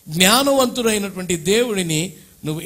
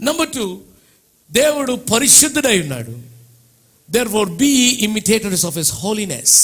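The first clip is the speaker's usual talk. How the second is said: fervent preaching; 3 semitones higher, 4 dB louder than usual. Acted. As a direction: announce the point with force. Preaching point two.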